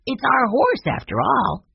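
put-on high eager little boy; child voice. Young Benny to his siblings, eager high boyish voice. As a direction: voice young Benny, bright and keen.

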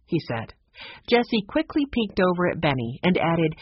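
ordinary delivery

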